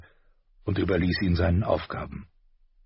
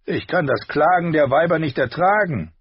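higher, louder petulant question; sulky prince; 9 semitones higher, 5 dB louder than usual. A narrator's reading. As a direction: whine it back. Prince scorns mourning.

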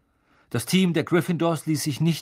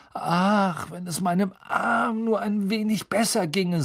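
mock-suffering sigh, higher; 8 semitones higher than usual. A narrator's reading. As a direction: whine theatrically about the arm. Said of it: "seufzte er".

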